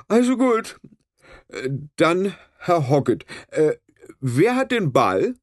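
high, slow, self-important; pompous referee. A narrator's reading. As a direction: take charge with puffed-up pomp. Referee takes charge of kickoff.

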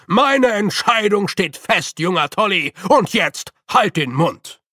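loud, final order; the Master silencing young Tolly; stern command. A narrator's reading. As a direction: cut him off sharply. Master shuts Grütze down.

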